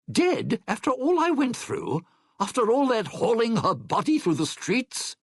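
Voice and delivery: high-pitched, outraged protest; exasperated outburst, voice shooting up; indignant disbelief. Acted. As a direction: protest it like a personal insult. Outraged at news she is dead.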